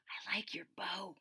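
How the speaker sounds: soft unvoiced whisper. Angela whispering to Ruby in class, hushed. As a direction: breathe it secretly across the desk. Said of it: "whispered Angela".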